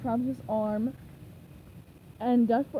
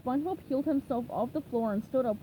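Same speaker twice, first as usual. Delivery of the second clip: ordinary delivery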